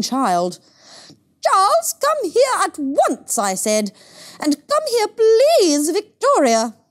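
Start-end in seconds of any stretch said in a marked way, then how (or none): 1.4-3.4 shrill, much higher and louder call across the park; high sharp call. Acted. Mother calling her son in the park, high and sharp. 4.4-6.8 shrill, much higher and louder call across the park; high sharp call. Acted. Mother calling her son in the park, high and sharp.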